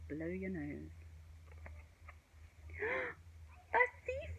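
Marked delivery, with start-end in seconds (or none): 2.4-3.4 high sharp gasp; startled gasp. A narrator's reading. Spotting the thief. 3.7-4.3 very high alarmed cry; shocked high exclaim; cried out. A narrator's reading. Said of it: "cried Rose".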